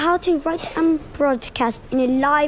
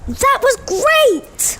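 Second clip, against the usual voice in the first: excited; 10 semitones higher, 4 dB louder, much slower than usual. Real, not acted. Child praises performance.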